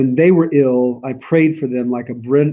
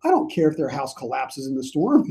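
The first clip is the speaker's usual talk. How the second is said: mock petulant; 9 semitones higher than usual. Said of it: "he's like".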